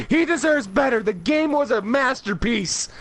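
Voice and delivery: high, loud words through laughter; laughing. Real, not acted. Cracking up while defending the game to the cohost, laughing.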